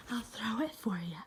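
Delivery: unvoiced teasing whisper. Real, not acted. Owner teasing the dog before the throw, whispering. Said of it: "whispered repeat".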